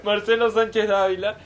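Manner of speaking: loud, high, laughing name call; triumphant announcement. Real, not acted. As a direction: nail the full name proudly. Finally gets name right.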